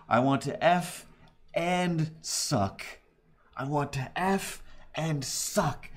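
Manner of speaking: every word spat out crisply and slowly; hammed-up enunciation of I want to F and suck; over-enunciated mock scream. Retelling the neighbour's scream with mock crisp diction. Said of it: "the most enunciated thing".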